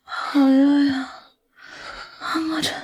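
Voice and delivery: high, drawn-out feeble moan; drugged, overheated. Acted. Drugged woman to her rescuer, dazed high moaning.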